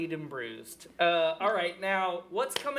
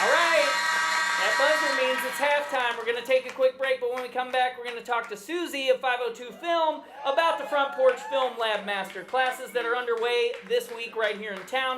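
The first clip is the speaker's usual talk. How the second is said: raised, projected emcee voice; high, loud show-host patter; announcer; 7 semitones higher, 6 dB louder than usual. Real, not acted. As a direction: announce the break to a live crowd. Host announces halftime over noise.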